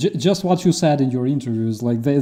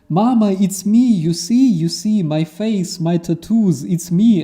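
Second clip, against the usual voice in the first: high, loud begging son on the phone; captured soldier pleading with his mother, high and loud; 7 semitones higher, 5 dB louder than usual. Retold or imitated. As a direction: beg your mother to recognize you. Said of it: "And he's like".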